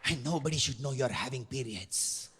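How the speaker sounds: hushed, low, secretive whisper; whispering pharmacist. Acted. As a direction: breathe it like a shameful secret. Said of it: "why are you whispering".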